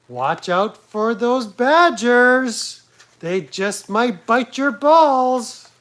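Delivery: high, slow, loud campy narrator; Randall impression. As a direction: stretch it out like a sassy nature narrator. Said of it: "that's my Randall impression".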